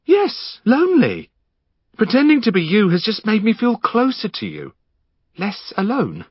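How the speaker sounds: manic Henry. Acted. Henry pinning Finn down, manic and giddy. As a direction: manic intruder mocking himself.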